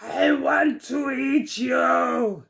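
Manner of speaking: slow, drawn-out growling threat; growling tiger. Acted. Tiger growling at old Buri, slow and drawn out. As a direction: growl each word out slowly. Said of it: "he growled".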